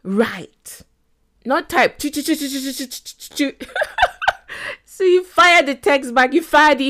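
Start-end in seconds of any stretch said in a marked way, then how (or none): 2.0-3.7 rapid string of high "choo" sounds; imitated typing noise. Shown as a demonstration. Imitates frantic typing on keys. 3.7-4.7 high, amused laughter; real laugh. Real, not acted. Laughing at own typing noise.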